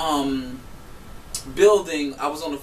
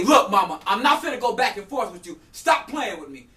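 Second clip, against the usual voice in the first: higher, loud exasperated warning; retold argument; 5 semitones higher, 5 dB louder than usual. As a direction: act out telling someone firmly to back off. Retelling a heated back-and-forth argument.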